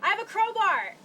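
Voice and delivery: loud excited yell; shouted. Acted. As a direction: announce it proudly, loudly. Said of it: "and I'll say".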